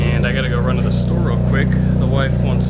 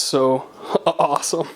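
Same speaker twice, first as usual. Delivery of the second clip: giggling through the words; laughing; slower than usual. Real, not acted. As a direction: laugh while you say it. Delighted at the lit underglow.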